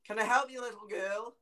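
put-on high, careful call to a child; concerned passer-by. Bloke offering help to unseen child, imitated concerned call. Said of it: "He went".

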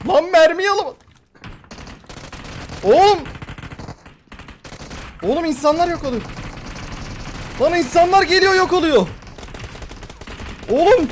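loud panicked yelling at the game; excited yelling. Real, not acted. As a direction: shout it in a frantic panic. Panicked gamer yelling mid-match.

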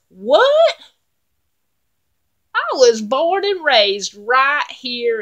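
high, loud "What?"; baffled troll. Acted. Troll baffled by the goat's talk of Norway, high and loud. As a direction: yelp like a confused troll.

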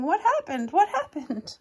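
high, alarmed cries of startled animals; creatures crying out. Acted. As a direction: call out like startled forest creatures. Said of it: "creatures woke up crying".

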